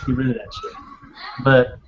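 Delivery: usual speaking voice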